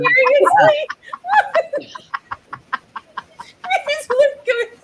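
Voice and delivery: high, loud laughing shock; laughing disbelief. Real, not acted. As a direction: squeal it through laughter. Shocked by the Rottweiler.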